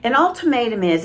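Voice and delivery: ordinary talk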